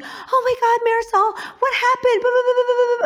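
lightly sneering imitation of Sally; mocking Sally. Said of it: "it's like".

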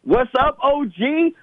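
high-pitched mimicry of an excited player; mock his excitement with a high-pitched greeting. Host mimicking the player's greeting from the clip, high and excited. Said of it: "He's excited".

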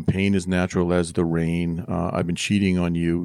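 normal talking voice

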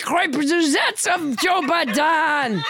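blustering mock-French proclamation; French Trump. French Trump threatens Biden and Pelosi, blustering French accent. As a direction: declaim it with absurd French vowels. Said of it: "your extremely realistic accent".

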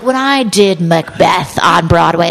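slow, grandly declaimed boast; pompous thespian. Retold or imitated. Director boasting to actress about Broadway, mock pompous. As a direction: imitate a self-important stage actor.

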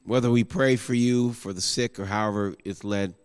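soft, low, prayerful appeal; quiet altar call. Acted. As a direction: gently invite them to turn, voice kept low.

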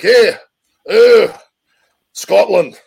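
high, loud, hammed-up Scottish burr; Scottish accent. Shown as a demonstration. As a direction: roll out a big exaggerated Scottish brogue. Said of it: "Scottish is down here".

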